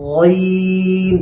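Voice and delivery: long drawn গইন, thick and full; heavy Ghain. Shown as a demonstration. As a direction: hold গইন heavy, lips relaxed. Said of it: "মোটা করে উচ্চারণ করতে হবে". (মোটা করে টানা গইন)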